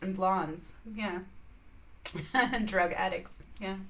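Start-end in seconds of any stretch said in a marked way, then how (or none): none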